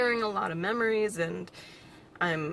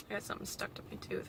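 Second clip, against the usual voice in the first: usual speaking voice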